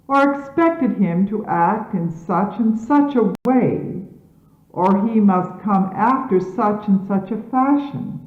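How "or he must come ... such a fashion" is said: usual speaking voice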